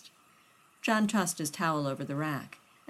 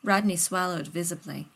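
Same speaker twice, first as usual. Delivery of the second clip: plain reading voice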